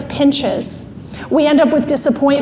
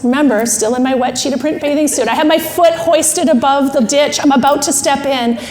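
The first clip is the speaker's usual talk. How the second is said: usual speaking voice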